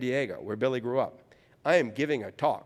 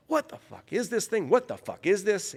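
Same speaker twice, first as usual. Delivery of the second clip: mock-apoplectic rant, pitched high without yelling; high-pitched exasperation, holding back the scream; apoplectic outburst; 8 semitones higher than usual. Furious ballplayer venting down the phone, imitated as apoplectic. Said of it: "he's apoplectic".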